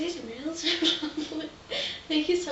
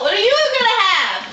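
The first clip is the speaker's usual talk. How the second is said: fast mocking echo; 5 semitones higher, 13 dB louder, faster than usual.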